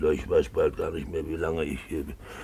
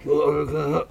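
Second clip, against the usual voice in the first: put-on high drunken call to Olga; 9 semitones higher than usual. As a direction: bellow for her, half jovial, half accusing. Drunk man calling his woman.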